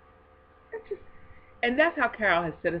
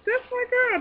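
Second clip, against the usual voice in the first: excited; 4 dB louder than usual. Real, not acted. Delighted about friend.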